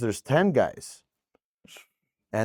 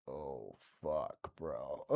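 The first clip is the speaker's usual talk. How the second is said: much lower and much softer; dreading whisper; 10 semitones lower, 19 dB quieter, much slower than usual. Retold or imitated. DJs facing thirty silent gangsters, hushed dread. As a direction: breathe it out in dread.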